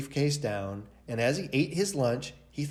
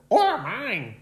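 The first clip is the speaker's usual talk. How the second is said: put-on higher, louder child voice; third child; 8 semitones higher, 4 dB louder than usual. A narrator's reading. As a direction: add it like a small kid. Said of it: "said the third".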